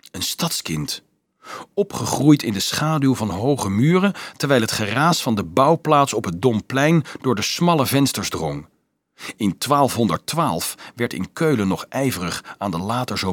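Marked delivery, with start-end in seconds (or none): none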